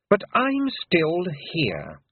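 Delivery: the Queen taunting coolly. A narrator's reading. Queen confronting Tiffany, cold and slow.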